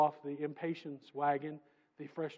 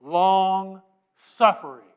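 Long and suffering split apart, loud; stressed word; 13 dB louder, slower than usual. Real, not acted. Preacher pointing out the next word, stressed. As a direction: pause between Long and suffering and hit both. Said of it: "Now notice the next word".

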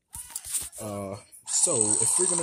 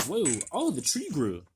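normal talking voice

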